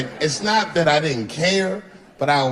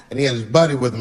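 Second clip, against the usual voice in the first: usual speaking voice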